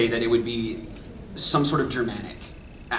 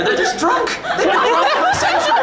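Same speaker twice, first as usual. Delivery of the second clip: high, loud, laughing through the words; real helpless laughter while talking; cracking up; 5 dB louder than usual. Real, not acted. Cracking up over drunken Titans joke.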